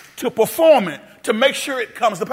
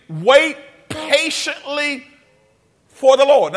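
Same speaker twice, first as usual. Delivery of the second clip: slow stressed reading; 4 semitones higher, slower than usual. Shown as a demonstration.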